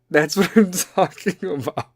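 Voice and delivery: laughing through the words. Real, not acted. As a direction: crack up while talking, voice lifted. Cracks up after the impression.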